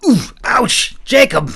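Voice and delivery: pained yelps, loud and high; boy yelping in pain. A narrator's reading. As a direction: yelp as an elbow hits you. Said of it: "the lad yelped".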